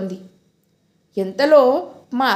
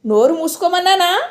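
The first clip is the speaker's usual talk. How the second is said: snapping; 6 semitones higher than usual. Acted. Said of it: "ఆవిడ కసిరింది".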